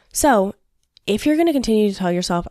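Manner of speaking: normal talking voice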